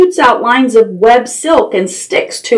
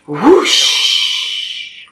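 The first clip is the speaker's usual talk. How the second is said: airy, drawn-out whoosh; whooshing wind; 4 semitones lower, 3 dB quieter than usual. A narrator's reading. Storyteller imitating a gust through the barn window, whooshing. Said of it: "a gust of wind blows".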